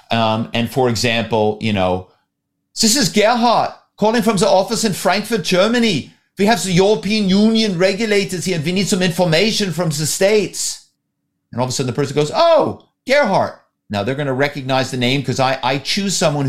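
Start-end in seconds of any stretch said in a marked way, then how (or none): 2.8-10.8 higher, put-on German accent of a Frankfurt executive. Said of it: "put on a German accent". 12.3-13.5 surprised recognition. Retold or imitated. Target recognises the caller's name.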